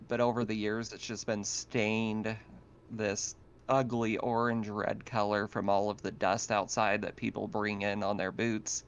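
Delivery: ordinary talk, nothing marked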